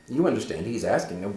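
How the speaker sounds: normal talking voice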